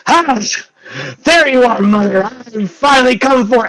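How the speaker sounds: high, loud villain's entrance; triumphant shout. Acted. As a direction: burst in crowing at the top of your range. Disheveled son bursting in triumphant.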